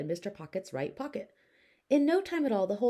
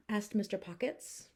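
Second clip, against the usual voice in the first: plain reading voice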